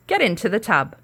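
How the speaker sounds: loud bossy witch; witch's order. Acted. Witch ordering Sasha into the tub, loud command.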